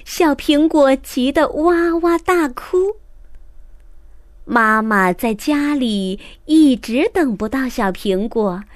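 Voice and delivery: ordinary delivery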